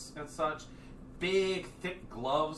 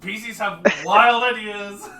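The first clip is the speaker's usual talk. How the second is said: mock exasperation; 9 semitones higher, 17 dB louder than usual. Real, not acted. GM complaining to the players about their wild ideas, hammed-up loud. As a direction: grumble it out to the whole table.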